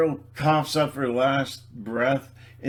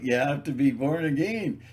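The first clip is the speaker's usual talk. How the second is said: ordinary talk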